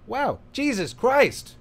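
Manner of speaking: high, loud, slowly drawn out; milk the fake shock; mock astonishment. Musician mocking his own amazement, loud and high.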